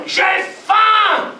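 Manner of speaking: high, loud shout on faim; belly howling. Acted. Starving belly howling at night, loud shout. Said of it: "son ventre hurle".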